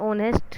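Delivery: "honest" begun on the vowel, h silent. Shown as a demonstration. Teacher giving an example for "an", "honest" with silent h. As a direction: say "honest" as if it had no h. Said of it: "एच है वो silent है".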